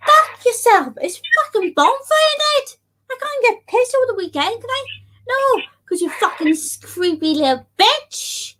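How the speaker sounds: high, fast angry tirade; angry rant. Real, not acted. Streamer ranting at a creepy hater, furious.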